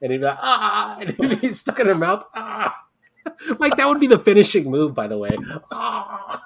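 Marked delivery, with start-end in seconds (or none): 0.4-1.0 high squealed Ah; mock high yelp; high mock yell. Imitating Mankind yell. 2.4-2.8 high squealed Ah; high mock yelp; high mock yell. Imitating Mankind yell. 5.7-6.4 high squealed Ah; high mock yelp; high mock yell. Imitating Mankind yell.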